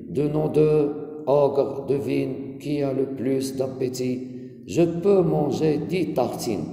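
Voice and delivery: expressive classroom reading with pauses between lines; poem reading. Acted. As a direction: read it out to a class, line by line. Returns to the poem and reads.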